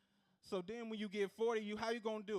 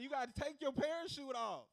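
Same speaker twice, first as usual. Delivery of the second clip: mock shout; 6 semitones higher, 5 dB louder than usual. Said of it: "I'm like".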